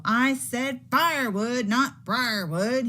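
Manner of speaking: loud yelp, slowing on briarwood. Acted. Varmint pricked by thorns scolds Buddy, yelped. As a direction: yelp it out in pain and irritation. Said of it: "he yelped".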